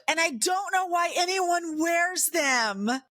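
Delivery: put-on high-pitched, fed-up teenage outburst; exasperated complaint. Teenager complaining to dad about tampons, exasperated. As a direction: push the pitch way up in protest.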